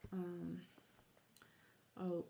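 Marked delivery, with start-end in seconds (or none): none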